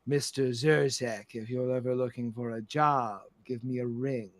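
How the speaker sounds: low, measured grande dame; gracious, sinister madam. Acted. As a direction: deliver a cool, unhurried farewell. Plays Madam Storybrooke.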